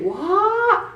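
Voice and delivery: high, surprised mock what, huh; playing innocent dieter's surprise. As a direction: play dumb, pitch jumps up. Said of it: "don't come to me and say".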